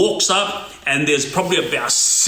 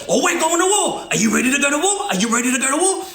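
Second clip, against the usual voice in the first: retold threat; 8 semitones higher, faster than usual. Older guy threatening war at the younger man, high mock threat. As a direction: dare him to go to war. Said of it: "start going off".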